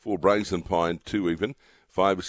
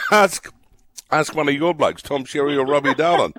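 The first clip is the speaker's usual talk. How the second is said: laughing; 8 semitones higher, 7 dB louder than usual. Real, not acted. Laughing at an accent question.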